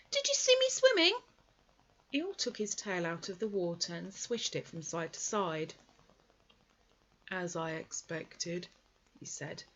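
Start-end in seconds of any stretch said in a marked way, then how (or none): 0.1-1.2 very high squeaky, thrilled voice; bubbling over with pride; excited squeak. Acted. Roo's voice held. 7.3-8.7 very slow, low, softer complaint; mournful donkey martyrdom; gloomy Eeyore. Acted. Eeyore's voice held.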